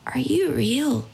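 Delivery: put-on slow, wondering small child voice; little girl. Five-year-old girl asking the naked painted cyclist, slow wondering child voice. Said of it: "She's about five".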